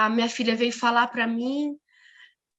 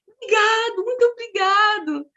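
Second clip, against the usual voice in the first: high, louder burst of gratitude; effusive thanks; 8 semitones higher, 5 dB louder than usual. Retold or imitated. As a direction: imitate a delighted, grateful stranger. Acts out the woman's grateful reply.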